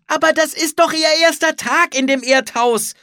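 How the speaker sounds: high, loud protest; shouted objection. A narrator's reading. Anne counters her brother as danger nears, shouting. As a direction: protest urgently at a shout, voice held up.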